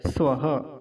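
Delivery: "स्वः" as sva-ha with short a; careful model "स्वः"; correct short vowel. Shown as a demonstration. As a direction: keep the first vowel short, breathe the ha. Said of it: "वैसा स्वाहा नहीं कहना है".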